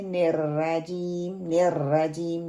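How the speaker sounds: نرجیم with thin light r, slow; thin ra error. Shown as a demonstration. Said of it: "را کو باریک پڑھ دیتے ہیں". (باریک را کی غلطی)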